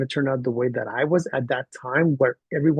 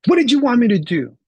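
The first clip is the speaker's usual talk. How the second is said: high, loud, pointed question; articulate child; 9 semitones higher, 5 dB louder than usual. Retold or imitated. Boy challenging his teacher about the task, articulate and high-pitched. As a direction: imitate a kid carefully demanding an explanation. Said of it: "he was very articulate".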